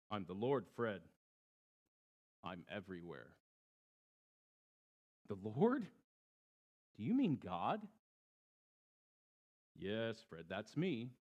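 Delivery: low, soft divine voice; God answering the stranded man, low and calm. A narrator's reading. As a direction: speak calmly from everywhere. God answers Fred in joke.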